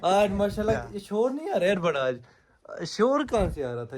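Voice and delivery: loud, high, showy grumbling about a noise; loud put-on annoyance at a mystery noise; pretend complaint about noise. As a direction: complain loudly so the guests feel ignored. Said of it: "ہم کہیں گے شور آ رہا ہے".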